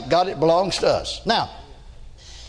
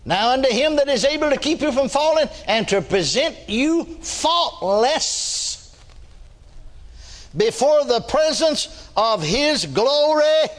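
high, ringing preacher intoning; preaching; 5 semitones higher than usual. Acted. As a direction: lift the verse like a sermon. Full verse intoned.

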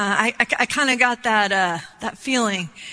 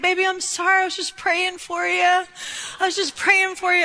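high-pitched, hurt apology, imitating dad; near tears; 7 semitones higher than usual. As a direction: plead as a wounded father, almost crying. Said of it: "almost started to cry".

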